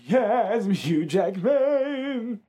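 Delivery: high, slow and loud; hammed-up Wolverine voice; Hugh Jackman voice. Claims to be Wolverine.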